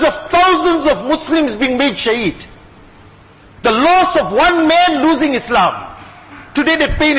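impassioned preaching. Real, not acted.

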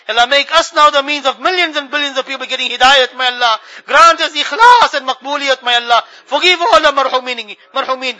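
ordinary delivery

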